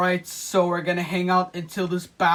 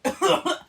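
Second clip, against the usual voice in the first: real coughing fit; 7 semitones higher than usual. Real, not acted. Choking on spray.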